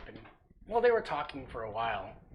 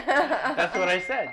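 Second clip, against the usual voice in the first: normal talking voice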